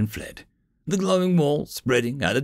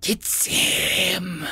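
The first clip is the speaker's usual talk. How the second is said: screeching hag; 7 semitones higher than usual. A narrator's reading. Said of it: "the orc hag screeched".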